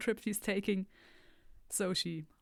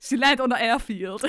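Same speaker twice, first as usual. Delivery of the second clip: louder, higher burst of amusement; loud amused blurt; 5 semitones higher, 12 dB louder than usual. Real, not acted. Laughing blurt at misunderstanding.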